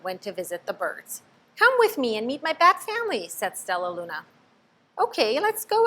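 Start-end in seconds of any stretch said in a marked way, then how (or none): 1.6-3.3 eager. A narrator's reading. Stellaluna invites birds. 5.0-5.9 high, bright agreement; eager little bird. A narrator's reading. Pip agrees to go with Stellaluna, high and eager. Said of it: "agreed Pip".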